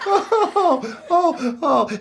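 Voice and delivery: high oh's trailing out of a laugh. Real, not acted. Host winds down after his own joke, laughing. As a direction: catch your breath from laughing.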